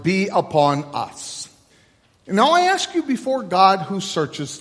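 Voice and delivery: measured, solemn liturgical chant-like reading; leading the liturgy. Acted. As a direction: intone the service to the congregation, unhurried. Pastor leads liturgy and prayers.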